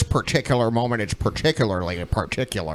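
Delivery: mocking Keen. Host teases the interviewer with a soft, low Keen imitation. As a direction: lightly parrot Keen's fussy phrasing, dropping low. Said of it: "She's using Keenisms already".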